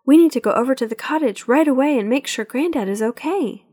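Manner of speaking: hint of an anxious child pleading; lightly voiced young girl. A narrator's reading.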